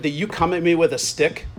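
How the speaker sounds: ordinary talk, nothing marked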